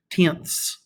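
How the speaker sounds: tenths with a crisp, breathy final TH; TH stressed. Shown as a demonstration. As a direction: hit the TH sound hard at the end of tenths. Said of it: "this emphasis on the T H".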